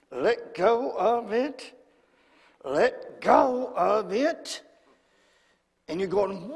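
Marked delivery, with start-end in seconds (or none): 0.1-4.6 slow, effortful word-by-word tugging; straining God prying. Acted. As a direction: act out prying a clenched fist open. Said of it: "trying to pry that out".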